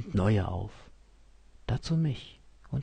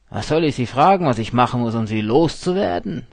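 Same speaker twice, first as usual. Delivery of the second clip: exasperated; 3 semitones higher, 10 dB louder, faster than usual. A narrator's reading. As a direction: push the pest away. Steuermann annoyed, wants to leave.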